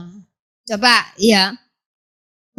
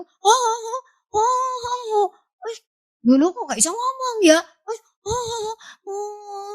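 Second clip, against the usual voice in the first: high, strangled mute oh sounds; mute grunting; 10 semitones higher, 4 dB quieter than usual. Acted. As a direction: try to speak but only grunt oh. Mute Zakaria trying to speak.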